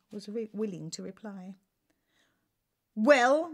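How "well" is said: loud, impatient roar. Acted. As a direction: bark it at the silent servants. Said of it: "roared the king impatiently".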